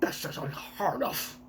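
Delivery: breathy scandalized whisper; whispered dragon line. Acted. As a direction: hiss it out, appalled. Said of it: "I give the worst voices to all of the, uh, celestial dragons".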